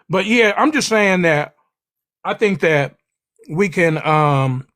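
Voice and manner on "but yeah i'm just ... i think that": normal talking voice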